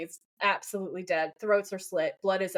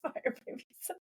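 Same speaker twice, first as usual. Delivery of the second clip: breathy whispered aside; 7 semitones higher, 10 dB quieter, faster than usual. Acted. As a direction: whisper it, giggling. Whispered repeat.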